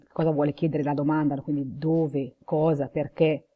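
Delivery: ordinary talk, nothing marked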